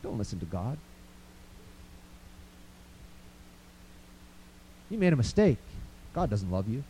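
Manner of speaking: low, soft whispered accusation; lightly voiced tempter, murmured low; Satan's voice. Satan whispering to the listener that they are worthless, soft and low. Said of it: "Satan gets on your lap and says".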